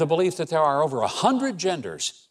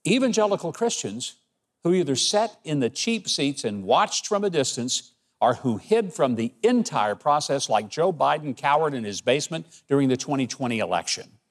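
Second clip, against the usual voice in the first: ordinary talk, nothing marked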